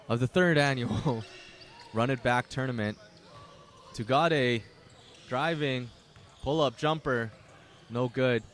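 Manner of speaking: play-by-play announcer calling the first half; live play-by-play. Acted. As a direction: keep the game call going. Live basketball play-by-play call.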